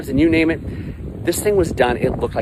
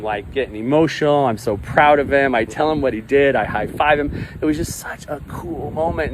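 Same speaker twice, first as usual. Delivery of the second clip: ordinary talk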